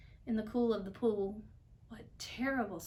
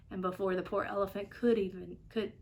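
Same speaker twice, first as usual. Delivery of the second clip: usual speaking voice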